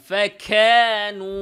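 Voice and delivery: ফেখেনু with the ক blown out like খ; overbreathy kaf mistake. Retold or imitated. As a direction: push air through the ক in ফেখেনু. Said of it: "বেশি শুদ্ধ করার যখন শখ ওঠে".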